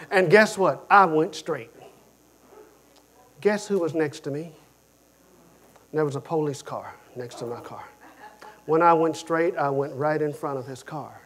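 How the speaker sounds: ordinary talk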